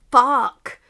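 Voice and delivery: loud heartfelt curse; a loud, feeling-filled swear. Acted. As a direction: spit it out with real feeling. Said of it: "said England with feeling".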